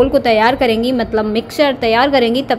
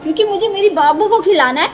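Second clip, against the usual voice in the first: put-on sing-song cooing to a baby, higher and lilting; baby talk; 4 semitones higher than usual. Aunt playing with her baby niece, sing-song baby talk. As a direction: coo and tease the little one like a doting aunt.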